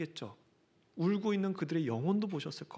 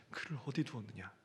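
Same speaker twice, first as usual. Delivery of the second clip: much softer and lower, with a grieving tremble; trembling voice; 6 semitones lower, 9 dB quieter than usual. Shown as a demonstration. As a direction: ask it quietly, as if your heart aches. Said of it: "떨리는 목소리로".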